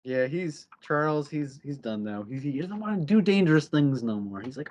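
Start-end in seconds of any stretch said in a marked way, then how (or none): none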